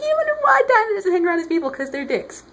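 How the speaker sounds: high, hammed-up indignation; indignant outburst. Explaining why Diana avoids her relatives, mock indignant. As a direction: start high and loud, then come down.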